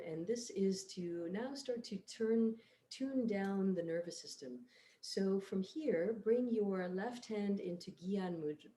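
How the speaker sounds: soft, unhurried yoga guidance; guided meditation. Real, not acted. Leads breathing and relaxation.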